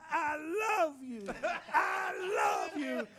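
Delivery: high, slow, laughing cry of love; tearful joy. Retold or imitated. Big Chief holding the boy's hand, high tearful cry. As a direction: pour it out half crying.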